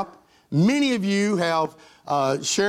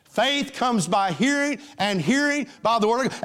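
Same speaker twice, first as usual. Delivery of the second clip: preached scripture; 4 semitones higher than usual. Acted. Preaching the verse.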